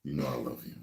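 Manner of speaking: low, soft pleading to his babe; sweet-talking low. Retold or imitated. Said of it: "I'm like".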